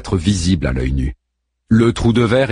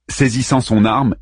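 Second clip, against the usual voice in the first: ordinary delivery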